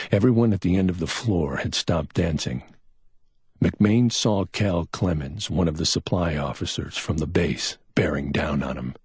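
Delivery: ordinary delivery, nothing marked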